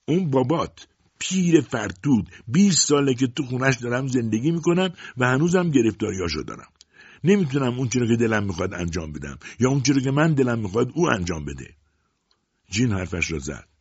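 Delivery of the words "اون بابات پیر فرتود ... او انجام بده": raised, higher voice of a bitter mother; loud voice. A narrator's reading. Mother ranting to her daughter about her old husband, raised voice. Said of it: "با صدای بلند گفت".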